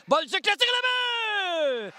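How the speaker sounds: rising shout on the goal; excited goal call. Real, not acted. Goal call by play-by-play announcer. (annonce de but exaltée)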